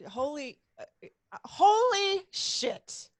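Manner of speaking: drawn-out, high, loud holy shit; stunned exclamation. Real, not acted. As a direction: let it burst out in disbelief, slowly. Host stunned by Ironman distances.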